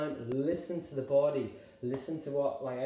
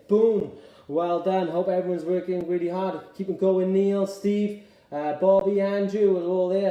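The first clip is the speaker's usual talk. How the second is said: loud coach calling out to the class; hearty, loud roll call of cheers; shouted encouragement; 8 dB louder than usual. Real, not acted. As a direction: project praise across the room. Cheering class members by name.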